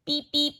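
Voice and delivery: high beep beep like a little horn; playful scooter horn. Acted. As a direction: toot it like a toy horn. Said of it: "went the scooter".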